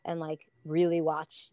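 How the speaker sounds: ordinary delivery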